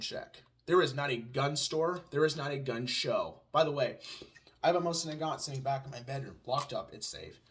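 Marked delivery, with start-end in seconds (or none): none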